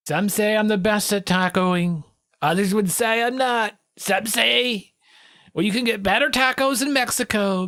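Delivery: a hint of a showman announcing Taco Tuesday; mock boastful president. Parodying the president on Taco Tuesday, mildly boastful.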